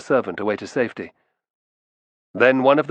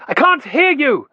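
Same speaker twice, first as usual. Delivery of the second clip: much higher, louder, slow shout; shouting over noise; 5 dB louder than usual. A narrator's reading. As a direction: strain to be heard across the dock.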